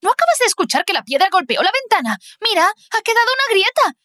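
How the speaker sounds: high, fast and agitated; frantic insistence. Acted. Alice begging Tara to see the crack, frantic and fast. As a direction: rush it out, desperate to be believed.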